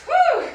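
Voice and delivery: loud, high breathy whew; relieved whew. Real, not acted. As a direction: blow out a big relieved whew. Exhale after finishing the set.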